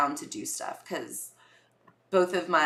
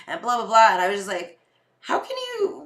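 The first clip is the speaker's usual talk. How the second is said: ordinary delivery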